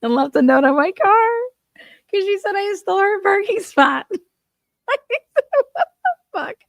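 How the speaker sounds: laughing so hard the words squeak out high. Real, not acted. Telling viewers the grocery lot story, squealing with laughter. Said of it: "story of some lady".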